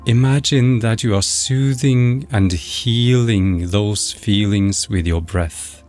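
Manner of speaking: ordinary delivery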